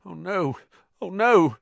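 high dread building to a loud cry; mounting high-pitched alarm; rising panic. A narrator's reading. Ron's panic builds as thing approaches.

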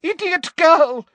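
high, loud exasperated scolding; furious woman, pitched right up; angry shout. A narrator's reading. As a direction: explode at the stupid answer. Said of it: "shouted Umbridge".

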